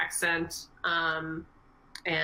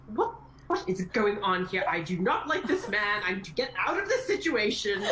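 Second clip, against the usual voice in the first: extremely posh British accent, slightly raised pitch; posh British owl familiar; 3 semitones higher than usual. Shown as a demonstration. Indignant familiar caught between Serial and Julian, posh British. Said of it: "has an extremely posh British accent".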